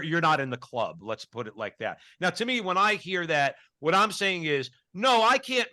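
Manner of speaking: normal talking voice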